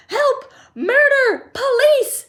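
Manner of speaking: very high, loud hysterical scream. A narrator's reading. Mother seeing son shoot up pipe, screaming. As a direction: shriek each word in panic. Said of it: "screamed Missus Gloop".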